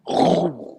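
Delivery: voiced vroom of a starting car; car engine roar. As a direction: mimic a car roaring to life. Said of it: "the car just goes".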